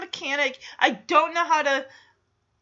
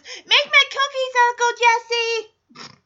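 mock demand; 8 semitones higher than usual. Mocks Stephanie's bossy demand.

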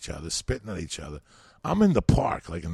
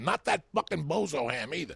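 usual speaking voice

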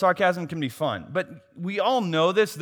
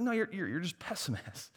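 ordinary talk, nothing marked

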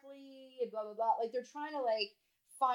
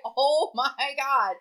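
mock exasperation; 5 semitones higher, 12 dB louder, much slower than usual. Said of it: "I was like".

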